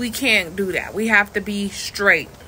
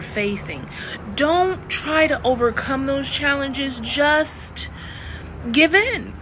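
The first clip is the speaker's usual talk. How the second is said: higher, whiny, ending in a fake cry; mock defeatist coach; 4 semitones higher than usual. Coach parodying bad advice to students: higher, ending in a fake cry. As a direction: ham up telling them to give up. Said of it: "it's not my job to be like".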